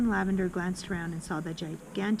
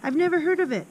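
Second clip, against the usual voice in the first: put-on higher, a lively young girl protesting innocence; schoolgirl voice; 8 semitones higher, 3 dB louder than usual. Pupil telling friends how she faked innocence, voiced higher. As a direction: pitch up into a put-upon little girl. Pupil retells her pretend act.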